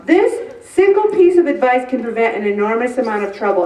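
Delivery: ordinary delivery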